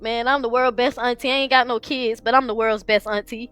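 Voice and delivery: ordinary delivery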